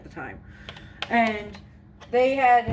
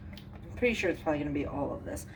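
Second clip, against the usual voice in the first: ordinary talk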